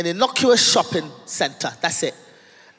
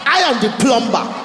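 mock Nigerian accent; 2 semitones higher than usual. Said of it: "Not even plumber".

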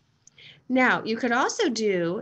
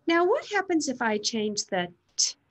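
higher, lifted classroom-teacher voice; teacher addressing class; 5 semitones higher, faster than usual. Acted. Teacher starts the class on word chaining, raised addressing voice. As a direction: announce the task to a roomful of pupils. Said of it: "So we can say".

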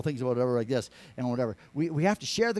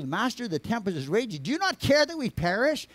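urgent plea; 7 semitones higher than usual. Acted. Disciples to Jesus in the storm, alarmed pleading. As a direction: pitch climbing sharply to a high cry.

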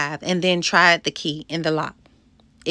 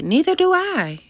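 high-pitched quick agreement; squeaky-high "me neither" reaction; emphatic agreement; 12 semitones higher than usual. A narrator's reading. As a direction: pitch leaps up in heartfelt agreement. Agreeing emphatically in story dialogue.

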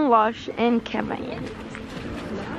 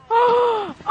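sharp gasp; 8 semitones higher than usual. Real, not acted. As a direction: gasp at the cold. First touch of snow.

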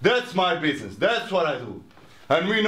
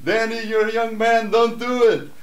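loud, higher mock yell to a brother; retold scream; 5 semitones higher, 5 dB louder than usual. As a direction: shout the warning across the loft. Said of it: "Shanza screams out".

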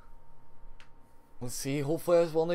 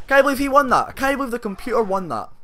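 disbelief; 7 semitones higher, 4 dB louder than usual. Real, not acted. Losing to the computer.

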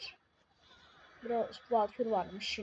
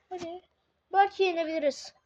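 ordinary delivery

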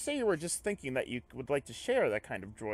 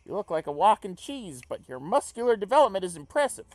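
high, loud, hearty character voice; enthusiastic comrade character; 8 semitones higher, 7 dB louder than usual. Acted. Comrade chats with labouring alien, enthusiastic high voice. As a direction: pitch it up and extol the workers.

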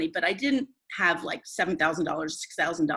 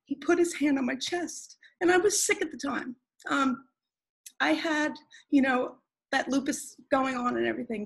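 close to tears; 6 semitones higher than usual. Real, not acted. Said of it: "I'm gonna cry".

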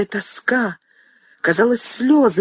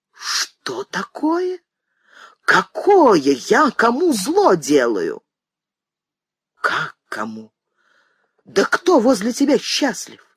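indignant disbelief; 4 semitones higher, slower than usual. Acted. Mother's indignant outburst.

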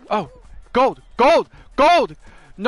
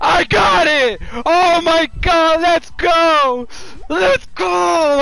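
loud, raised cheering; triumphant shout; 4 semitones higher, 4 dB louder, slower than usual. Real, not acted. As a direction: yell it in disbelieving joy. Celebrates win.

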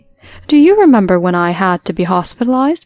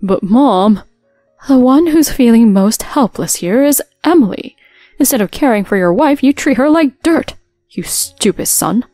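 scolding parent; 2 semitones higher, 5 dB louder than usual. Acted.

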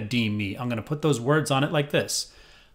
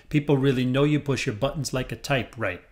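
words in rhythm, faster. Shown as a demonstration. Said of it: "this is eventually going to be faster".

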